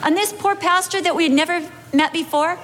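ordinary delivery

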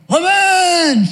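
high, loud grumpy yell of woman; husband's sleepy bark. Retold or imitated. Husband woken by a shove, grumpy yell. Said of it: "He's like".

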